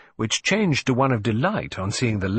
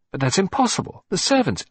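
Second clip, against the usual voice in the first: startled protest; 9 semitones higher than usual. Acted. Hastings protests, cut off.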